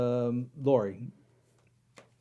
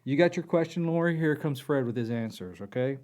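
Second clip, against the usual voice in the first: ordinary talk, nothing marked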